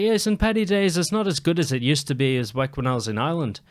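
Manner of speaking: lightly imitated Irishwoman telling a story; attempted Irish lilt; put-on Irish accent. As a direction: keep up an amateur Irish accent. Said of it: "I gotta keep the accent".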